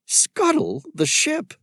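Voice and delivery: high, slow disbelieving echo; incredulous. A narrator's reading. Crewman echoing the plan in disbelief, incredulous. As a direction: repeat it as if it can't be true. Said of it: "his voice incredulous".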